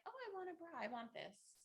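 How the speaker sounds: lightly voiced excited kid; eager kid voice. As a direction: play your younger self wanting it right now. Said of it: "I was like".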